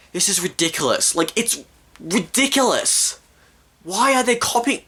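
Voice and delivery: rising, high-pitched indignation; exasperated. Real, not acted. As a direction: build from disbelief to a high protest. Rants about the copying.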